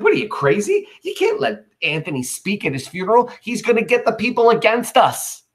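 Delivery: high-pitched, incredulous outburst; hammed-up exasperated disbelief; alarmed objection. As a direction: protest as if the plan is insane. Cassius objects in modern paraphrase.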